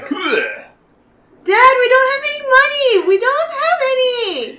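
desperate plea. Acted. Son pleads with abusive father after punch, crying out. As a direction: beg your dad to stop.

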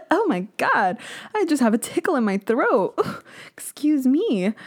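feigned little oops; mock innocent. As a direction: play innocent after a fake cough. Pretends coughed names were accidental.

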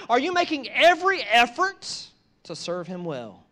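rising to a loud, high preaching shout; building preacher's cry. Acted. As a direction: build the sermon to a climax. Preaching at full force.

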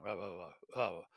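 soft, low knowing oh; the ghost teases Scrooge, low and soft; low teasing ghost. Acted. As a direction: tease him gently, deep and hushed.

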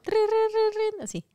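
high ringing rin rin; imitated alarm ring. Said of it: "Suena la alarma".

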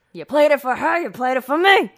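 loud, lightly voiced tough guy; a hint of a gruff movie star; Humphrey Bogart. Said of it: "That's not what Humphrey Bogart sounds like".

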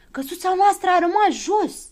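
loud, high-pitched cry; excited shout. A narrator's reading. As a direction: shout the discovery to everyone. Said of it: "începu să strige Habar n-am".

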